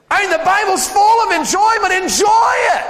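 high, loud joyful cry; preacher exulting; preaching shout. Real, not acted. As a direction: lift the whole room. Preacher urging the crowd.

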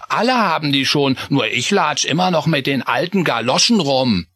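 put-on high child voice, admiring then sulky; jealous little Chris; envious mouse boy. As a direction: want his shiny boots.

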